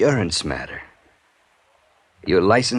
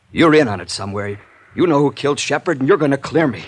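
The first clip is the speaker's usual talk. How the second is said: higher and louder, screaming out of control; hysterical rage; 10 semitones higher, 9 dB louder than usual. Acted. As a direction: lose it completely, screaming the threat. Gunman breaks into a frenzy.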